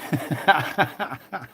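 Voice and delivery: short burst of open laughter; quick run of ha-ha laughter. Real, not acted. Laughs at the drunk accent joke.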